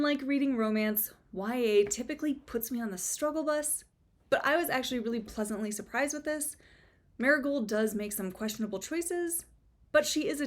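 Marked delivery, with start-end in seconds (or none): none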